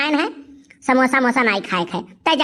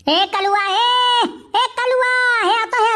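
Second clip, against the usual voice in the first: high calling cry; high hollering of his name; 10 semitones higher, much slower than usual. Acted. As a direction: shout for the boy to come over. Calling the boy from afar.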